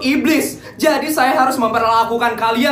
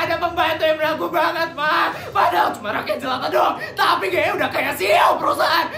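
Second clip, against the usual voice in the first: bratty tattling; 5 semitones higher, 4 dB quieter than usual. Acted. Spoiled kids tattling to mama.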